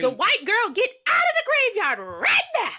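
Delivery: very high loud imitation of her yelling; mock yell. Joining the imitation of the yelling woman, high mock holler.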